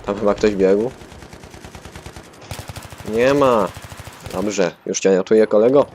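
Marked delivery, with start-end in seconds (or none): none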